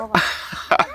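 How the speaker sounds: short breathy chuckle; quick, quiet laugh. Real, not acted. Laughs after greeting viewers.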